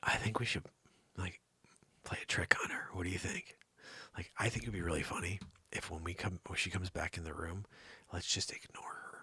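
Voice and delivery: low, hushed scheming; conspiratorial. Pretend. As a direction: let the audience in on a secret, very softly. Plotting a prank while she's away.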